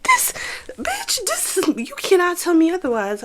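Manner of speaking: high, squealing This, bitch; showing off her man, high and giddy; squealy excited boast; giddy bragging. Retold or imitated. Gushing over her man.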